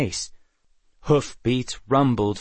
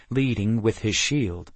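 ordinary delivery, nothing marked

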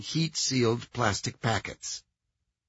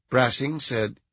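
ordinary delivery

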